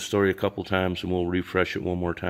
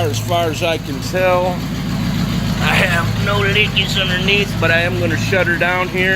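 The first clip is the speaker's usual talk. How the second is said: raised, higher voice over motor noise; shouting over engine; 10 semitones higher, 7 dB louder than usual. Real, not acted. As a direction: project hard to be heard above the engine. Talking over a running engine.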